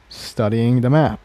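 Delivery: usual speaking voice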